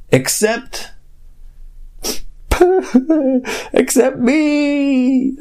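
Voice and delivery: high, slow fake wail; mock crying. Host pretending to cry because cohost left. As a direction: whimper it like a jilted friend.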